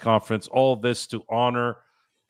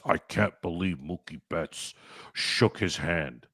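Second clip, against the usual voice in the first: slow, softer sneering complainer; mocking whiner; 3 semitones lower, 8 dB quieter than usual. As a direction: mutter it like a sulky critic. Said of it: "when they talk like this".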